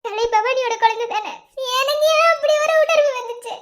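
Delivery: anguished, sob-broken speech; crying. Acted. Sleepless wife burdened by doubts about a child, crying. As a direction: let the sobs break up each phrase. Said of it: "நீ அழுதுகிட்டு இருக்க".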